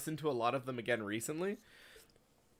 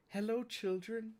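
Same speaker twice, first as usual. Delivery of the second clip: much higher, slow gentle voice; soft mock Mister Schnrub; 10 semitones higher, 4 dB quieter, slower than usual. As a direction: coo it like the character. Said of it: "quoting Mister Schnrub".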